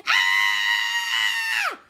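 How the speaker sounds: loud scream in a low voice. A narrator's reading. Kid screaming while dragged, loud low scream. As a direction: let out a wild scream. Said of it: "Stop screaming".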